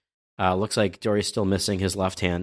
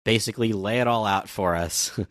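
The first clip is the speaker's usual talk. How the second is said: ordinary talk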